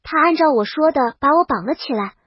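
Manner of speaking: ordinary delivery, nothing marked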